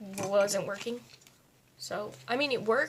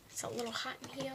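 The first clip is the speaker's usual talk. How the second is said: whisper; 10 dB quieter, much faster than usual. Real, not acted. Quick whispered aside.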